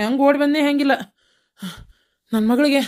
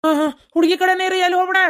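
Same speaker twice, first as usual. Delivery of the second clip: high, agitated instruction; flustered; 8 semitones higher, 3 dB louder than usual. Acted. Husband telling wife to stay with the girl, flustered. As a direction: insist anxiously they stay put.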